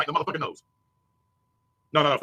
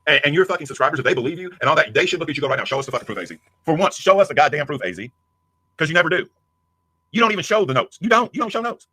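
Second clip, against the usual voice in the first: furious shouting; 2 semitones higher, 6 dB louder than usual. Real, not acted. Streamer yelling at rival to show the proof, furious. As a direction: shout him down, demanding the proof.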